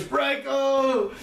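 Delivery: high, drawn-out cry of the name; anguished scream. A narrator's reading. Izumi screams as she reaches out, high anguished cry. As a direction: wail the name, pitch way up. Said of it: "he screams".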